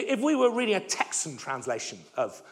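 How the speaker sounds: ordinary delivery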